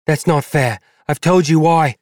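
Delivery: high, loud woman's voice, pleading; protest, upset and insistent. Acted.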